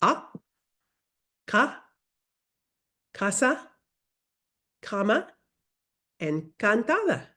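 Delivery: teacher leading a repeat-after-me drill; pronunciation drill. Shown as a demonstration. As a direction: call out "casa", "cama", "cantada" word by word for echoing. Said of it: "Repeat after me".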